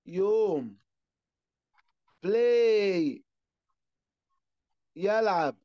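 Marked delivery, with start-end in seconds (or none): 2.2-3.2 بلاي as a drawn-out "play"; long A in بلاي. Shown as a demonstration. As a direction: hold the final "ay" of بلاي. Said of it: "أنا بمد في الإيه". (مدّ الإيه في «بلاي»)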